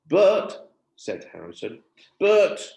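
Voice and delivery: loud nervous protest; anxious child. A narrator's reading. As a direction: blurt it out nervously. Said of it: "starting to feel extremely anxious".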